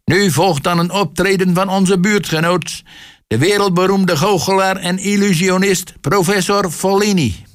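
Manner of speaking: high-pitched master of ceremonies; chairman's announcement. A narrator's reading. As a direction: announce the star act to the hall, pitched high. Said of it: "welkte de voorzitter".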